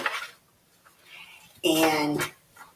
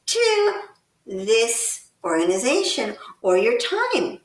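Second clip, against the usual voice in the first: ordinary talk, nothing marked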